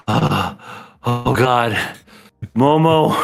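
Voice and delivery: acted winded gasp, then rising plea; mock exhausted collapse. Runner collapsed on the floor offering help, mock winded. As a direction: play a worn-out runner just arriving.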